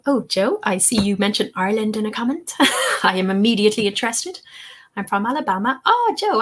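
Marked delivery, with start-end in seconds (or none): 5.8-6.4 delighted exclamation. Real, not acted. Excited at a shared connection.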